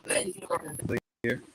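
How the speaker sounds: normal talking voice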